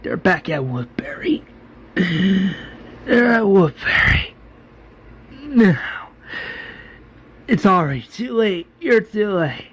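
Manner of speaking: acted dying words, slow and strained; dying man. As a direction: squeeze it out with failing breath. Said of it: "Martinez slowly dies".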